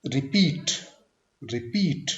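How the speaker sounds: Repeat with short रि, strong पीट; light रि, hit पीट; second-syllable stress. Shown as a demonstration. Teacher modelling word stress, repeat stressed on पीट. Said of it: "second syllable पे stress".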